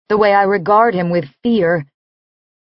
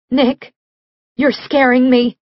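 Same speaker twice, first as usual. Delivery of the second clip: a frightened plea, voice slightly up; trembling fear; 4 semitones higher than usual. Acted. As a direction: call his name in real fear, a tremble underneath. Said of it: "don't have to fake the tremble in my voice".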